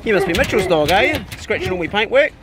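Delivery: high, loud, cheery dog-greeting voice; high pet talk. Real, not acted. Greeting the neighbour's dog in the drive, high loud pet talk. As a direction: gush up high at a friendly dog.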